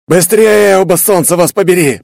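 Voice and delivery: high, loud snarling shout; angry growl. A narrator's reading. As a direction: snarl it out furiously. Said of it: "прорычала Наму".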